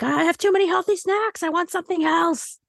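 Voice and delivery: high, whiny inner voice; mock whine about too many healthy snacks; whiny craving voice. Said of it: "you're like".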